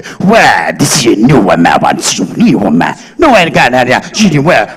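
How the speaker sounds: low, twisted-mouth fake American accent; mock Hollywood English. Mocking Hollywood heroes' dialogue delivery, deep fake American accent. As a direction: talk like you ate a bitter leaf, in a fake American accent. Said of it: "मुंह बिगाड़ के बातें करते हैं".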